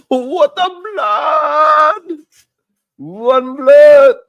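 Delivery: high, loud Caribbean patois exclamation, drawn out; Caribbean accent shout. Retold or imitated. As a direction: bellow it in Caribbean patois, stretching the words. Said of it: "Caribbean accent from my people".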